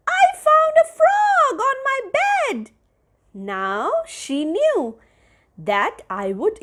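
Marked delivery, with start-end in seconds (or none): 0.1-2.7 put-on high, bright child's shout of discovery; excited child's yell. A narrator's reading. Child yelling to mother about a frog, excited shout. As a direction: yell it out like a thrilled kid. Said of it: "when I yelled out".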